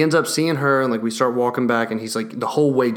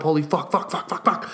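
mock dog panic, trailing into a whispered string of curses; panicking dogs; 4 semitones higher, 3 dB quieter than usual. The dogs at the glass door, losing it, in a whispered mock panic. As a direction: fade the curses into a frantic breathy whisper.

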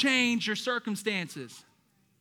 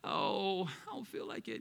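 imitated reluctant moan; reluctant churchgoer; 9 dB quieter than usual. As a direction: sigh it out, half under your breath. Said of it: "Many of us were like".

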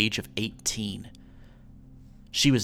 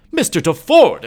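high, loud outraged cry of his name; indignant exclamation; 11 dB louder than usual. A narrator's reading. Heiress rebuffing her suitor's proposal, exclaiming indignantly. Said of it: "exclaimed Lola".

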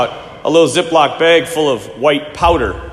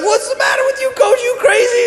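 imitated panic, pitched way up; freaked out. Players to their coach as a rattlesnake appears, mock panic. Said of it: "And the kids freak out".

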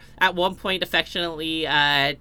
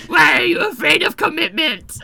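higher, louder raspy shout; loud cigarette voice; 11 semitones higher, 8 dB louder than usual. Acted. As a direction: yell it like a smoky diva. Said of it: "that was so loud".

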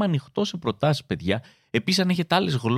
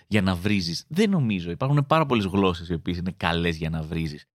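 ordinary talk

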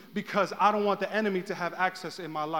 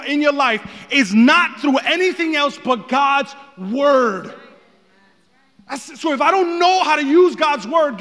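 raised, loud preacher's voice; full-force preaching; 6 semitones higher, 11 dB louder than usual. Real, not acted.

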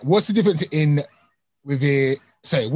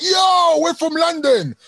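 excited shout; 11 semitones higher, slower than usual. Retold or imitated. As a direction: yell it like your city just got a shoutout. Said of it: "we'll start going mad".

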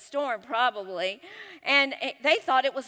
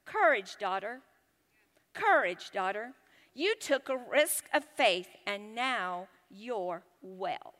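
slow, soft, lower reassurance; reassuring; 5 semitones lower, 4 dB quieter than usual. Acted. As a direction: gently reassure a frightened daughter, with pauses between phrases. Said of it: "Then he reassured her".

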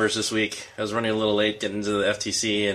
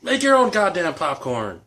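mock angry snap; 8 semitones higher, 4 dB louder than usual. Joking angry quip.

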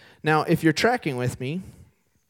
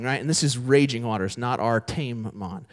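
ordinary talk

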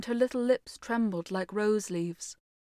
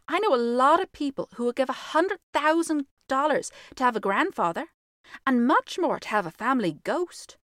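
put-on lightly raised girlish voice; a hint of a bold young girl; 5 semitones higher, 4 dB louder than usual. Virginia answers the ghost.

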